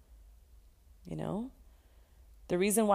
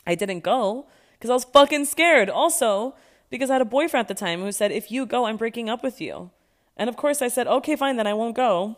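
loud, higher, angry-at-herself rant; loud heated vent; 6 semitones higher, 7 dB louder than usual. Real, not acted. Telling listeners about skipping Chile, loud and heated. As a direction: vent it loudly.

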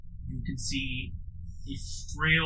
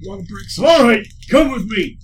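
put-on old trainer voice; 7 semitones higher, 9 dB louder than usual.

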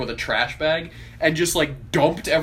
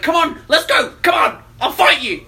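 high, loud taunting yell; mock challenger; 12 semitones higher, 7 dB louder than usual. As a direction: goad them into a fight, hammed-up. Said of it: "He'd be like".

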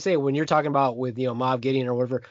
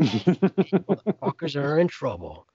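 slow relish; slower than usual. Real, not acted. Savouring the threat.

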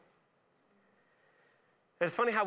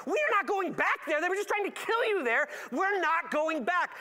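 much higher pleading refusal, slowing at the end; whiny protest; 10 semitones higher, 3 dB louder than usual. Retold or imitated. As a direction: beg him not to go, whining. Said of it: "it sounds like they had that tone".

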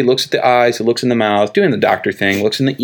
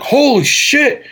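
high, drawn-out freaked-out exclamation; mock shock; slower than usual. Doctor freaked out by what he finds, mock shock. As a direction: stretch it out high, acting stunned.

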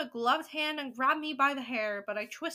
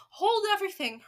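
high, loud storybook shriek; 8 semitones higher, 7 dB louder than usual. Acted. Witch in the back row halting the crowd, shrieking. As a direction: cry out to halt the whole room. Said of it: "Her shrieking voice echoed through the ballroom".

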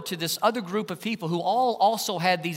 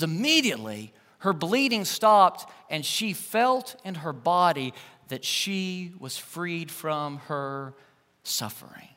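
reads it slower, fading to a whisper on "suffering"; scripture reading; 2 semitones lower, slower than usual. Acted.

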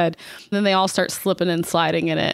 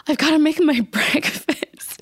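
frantic woman; 9 semitones higher than usual. Retold or imitated. Sarah Jessica Parker freaks out.

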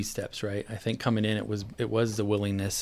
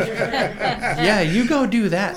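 laughing; 11 semitones higher, 9 dB louder, much slower than usual. Real, not acted. Laughing at wife's complaint.

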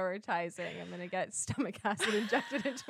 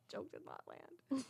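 laughing softly; 3 semitones higher, 14 dB quieter, slower than usual. Real, not acted. Laughs at own failed joke.

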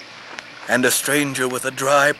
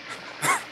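weak cough; 5 dB quieter than usual. Acted. Sick man coughing.

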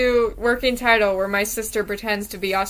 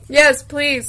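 normal talking voice

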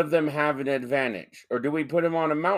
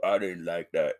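lower, mock Floridian complainer; hammed-up Floridian accent, pitched down; 5 semitones lower, 3 dB quieter than usual. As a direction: put on a Floridian accent for an offended listener. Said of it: "I gave him a Floridian accent".

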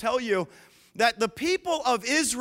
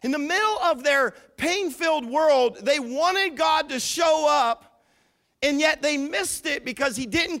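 preaching at full force; 5 semitones higher than usual. Real, not acted. Preaching climax.

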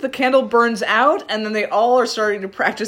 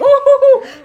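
high, louder evil giggle; creepy childlike laugh; 8 dB louder than usual. Pretend. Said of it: "It's creepy 'cause it sounds, like, childlike".

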